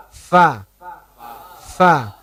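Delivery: "Fa" dengan bibir bawah menyentuh gigi atas, ringan; light fa demo. Shown as a demonstration. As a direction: let the "Fa" air out lightly. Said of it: "Tidak perlu ditekan". (ucapkan "Fa" ringan tanpa tekanan)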